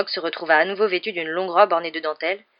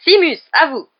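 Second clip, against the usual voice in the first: high, loud call to a pupil; 10 semitones higher, 10 dB louder than usual. A narrator's reading. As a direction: fire off the summons loudly. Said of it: "lança le professeur Lupin". (appel lancé haut et fort)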